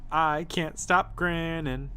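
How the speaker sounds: sing-song voice. Acted. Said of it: "In a sing-song voice".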